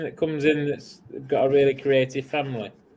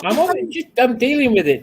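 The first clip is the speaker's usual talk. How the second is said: flustered; 11 semitones higher, 6 dB louder than usual. Real, not acted. Flustered reply to Clara.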